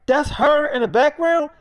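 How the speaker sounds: high, loud incredulous question; mad disbelief. Retold or imitated. Friend hearing whose vocals they are, mad disbelief. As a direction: act out an outraged double-check, voice jumping up. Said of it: "still mad about the whole thing".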